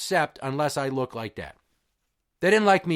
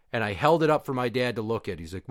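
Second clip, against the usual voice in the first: usual speaking voice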